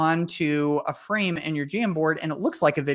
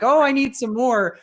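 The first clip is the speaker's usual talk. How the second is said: high, louder eager plea; eager teacher voice; 8 semitones higher, 4 dB louder than usual. Retold or imitated. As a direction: play a teacher begging for more. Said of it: "and they're like".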